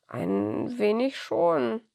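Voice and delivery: slow, weary Nikolaus voice; tired old man. Acted. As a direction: answer slowly like a sleepy old man.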